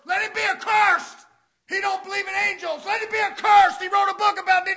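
loud, high fire-and-brimstone preaching; preaching shout. Acted. Preacher shouting to the church about Canaan. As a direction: thunder it at the congregation.

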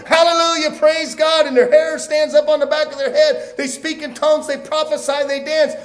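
high, loud revival fervor building to a shout; full-force preaching. Real, not acted. Preacher describing people's revival excitement to congregation, fervent preaching. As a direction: preach it at the top of your voice.

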